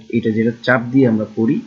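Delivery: ordinary talk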